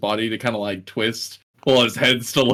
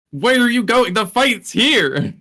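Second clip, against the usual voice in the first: in-character shout; 9 semitones higher, slower than usual. Acted. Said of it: "he'll kinda turn and face and be like".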